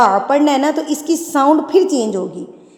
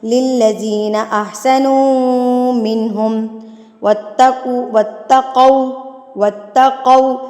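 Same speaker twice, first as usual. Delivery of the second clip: slow, drawn-out melodic tilawat; Quran recitation; much slower than usual. Shown as a demonstration. Teacher recites the verse in tilawat style. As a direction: recite with long stretched vowels.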